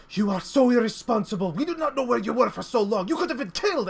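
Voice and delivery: high, loud scolding rant; angry worried outburst; freaking out. Acted. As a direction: berate him for running off. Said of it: "she's, like, freaking out".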